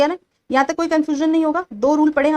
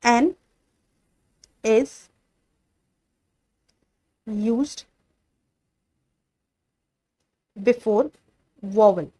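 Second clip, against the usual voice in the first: slow, deliberate dictation pace; drawn-out classroom dictation; dictating slowly; 3 semitones lower, much slower than usual. Shown as a demonstration. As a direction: spell the rule out word by word for notes. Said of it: "slow dictation of the rule".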